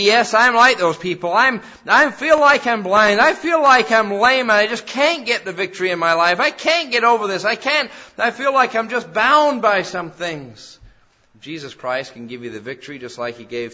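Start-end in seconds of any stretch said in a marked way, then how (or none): 0.0-10.8 louder, higher, pleading lament; despairing confession. Retold or imitated. Listener imagined confessing feeling bound, loud pleading lament. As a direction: pour out the struggle. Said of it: "you may say".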